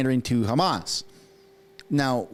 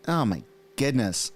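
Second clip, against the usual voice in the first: ordinary delivery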